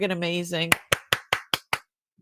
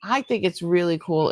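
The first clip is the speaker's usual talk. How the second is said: normal talking voice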